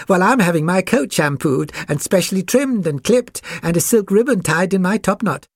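high-pitched show-off puppy voice; vain poodle. A narrator's reading. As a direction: preen aloud in a high character voice. Vain poodle boasts of pampering.

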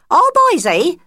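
high, loud bright friend voice; a shrill-pitched busybody mouse cooing; fussy friend mouse. Acted. Friend cooing over the new cubs, high and loud.

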